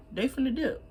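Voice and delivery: laughing through the words. Real, not acted. Laughs at her own line.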